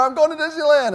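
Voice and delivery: high-pitched excited yell of the slogan; yell into camera. Retold or imitated. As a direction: belt it at the camera. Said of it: "I yell in the camera".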